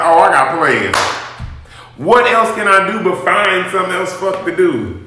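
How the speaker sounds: ordinary delivery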